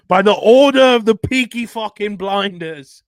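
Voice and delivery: loud, high Irish gangster shout; Irish accent. Retold or imitated. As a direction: bellow it in a thick Irish brogue. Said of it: "the ongoing Irish accents".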